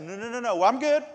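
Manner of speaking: rising high and loud; loud squeal of protective reluctance; panicky mock refusal. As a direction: blurt out a comic, high-pitched refusal. Worker hastily refusing boss's request.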